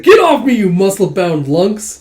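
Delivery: high, loud outburst easing into slow grumbling; exasperated protest, starting at a shout; acted irritated yell. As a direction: shove them off with your voice. Character protests being hugged.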